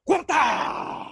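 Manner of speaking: high, loud, sudden mimicked outburst; mock impact shout. Acting out accidentally hitting his wife, loud sudden shout. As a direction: burst it out to act the blow.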